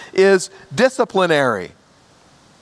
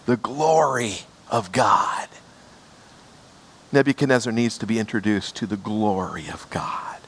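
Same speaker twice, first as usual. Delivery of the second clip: hushed whisper; 7 semitones lower, 5 dB quieter than usual. Real, not acted. Preacher whispering to the congregation about God's glory. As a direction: lean in and breathe the words.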